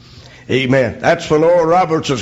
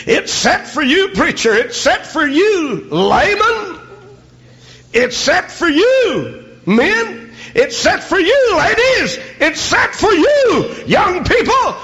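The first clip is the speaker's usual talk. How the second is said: rising preaching litany; 12 semitones higher, slower than usual. Acted. Preacher calling out to every group, building shout. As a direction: build every call higher.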